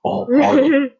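high, loud strained start; strained high villain voice. Retold or imitated. Host strains for the high villain voice. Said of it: "I can't do the other voice".

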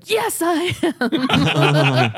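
mock-stern yes, breaking into a laugh; laughing admission. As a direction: drag it out while cracking up. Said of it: "And he's like".

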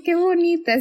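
gushing. Retold or imitated. Gushing over a sweet scene.

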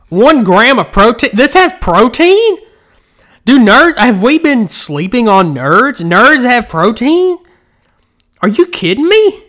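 high, loud disbelief; shocked that candy has protein; surprised. Real, not acted.

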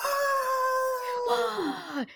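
big gasp. Pretend. Mock studio audience reaction.

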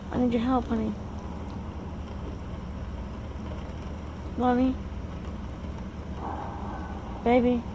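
quiet, coaxing pet names; softly calling a sleeping husband; soft wake-up calls. Real, not acted. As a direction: coax a sleeping husband awake gently. Gently waking sleeping husband.

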